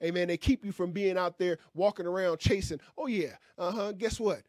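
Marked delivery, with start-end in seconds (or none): none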